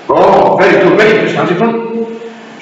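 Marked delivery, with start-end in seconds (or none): none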